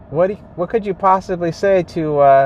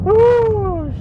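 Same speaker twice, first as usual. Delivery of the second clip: high exasperated whoop. Real, not acted. Venting frustration while driving.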